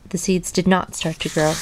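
ordinary delivery, nothing marked

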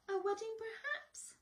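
high, fast fussy shopkeeper; chirpy high salesman; shop assistant voice. Acted.

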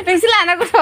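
loud laugh then words said through laughter. Real, not acted. Friend laughing loudly while teasing the others.

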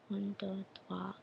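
very soft private count; counting to oneself. Real, not acted. Counting up to find the word.

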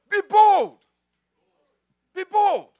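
loud, high preacher's shout; rousing exhortation. Real, not acted. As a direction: fire up the congregation with a shouted command. Preacher exhorts congregation to be bold.

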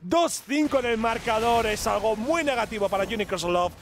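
sustained sing-song fight call, winding down; esports play-by-play. Acted. Caster calls a team fight and score, excited play-by-play. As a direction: rise through the fight, then settle to sum up. Live match casting.

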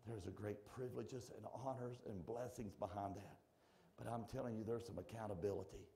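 soft, low, intimate preaching; quiet appeal. Real, not acted. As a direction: lean in and speak gently to each listener. Quiet intimate altar-call appeal.

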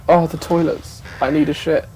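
usual speaking voice

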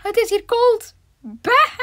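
high squeaky mock voice. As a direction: squeak it out like a cartoon. Hoge gespeelde stem. (hoge piepstem)

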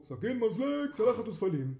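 loud, high eager call; loud, excited fishermen. A narrator's reading. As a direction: call out orders to lay the table. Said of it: "הדייגים קראו".

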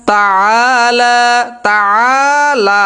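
তাআলা slow, both আ held long; long drawn vowels. Shown as a demonstration. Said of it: "টান হবে তাআলা".

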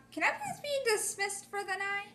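high, soft polite plea; small-voiced request to be excused; timid request. Asks to be excused, quoted.

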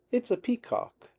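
high, amused answer; laughing. A narrator's reading. Minnie laughs explaining peacock to Lily, high and amused. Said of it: "Minnie laughs".

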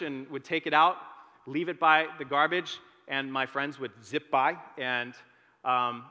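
normal talking voice

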